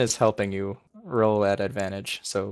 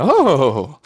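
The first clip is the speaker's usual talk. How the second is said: loud, higher sudden "Oh!"; startled gasp-word; 6 semitones higher, 10 dB louder than usual. Acted. The clerk startled by Galena's outburst, a loud gasp. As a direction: drop everything and gasp it. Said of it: "gasps and".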